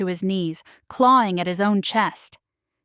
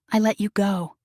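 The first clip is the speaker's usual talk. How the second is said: ordinary delivery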